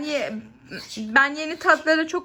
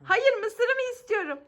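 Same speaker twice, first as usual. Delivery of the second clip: mock whining; 7 semitones higher, slower than usual. Pretends the corn was taken away.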